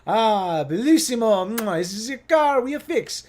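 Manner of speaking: loud, high, hammed-up Italian accent; Italian mechanic. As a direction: call out and protest as a mock Italian mechanic. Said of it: "typical Italian mechanic".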